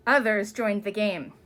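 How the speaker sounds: plain reading voice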